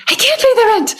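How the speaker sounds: high, far-off squeaky damsel voice; damsel in distress. Acted. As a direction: plead as a helpless melodrama heroine. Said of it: "a victim".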